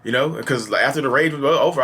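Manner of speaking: ordinary talk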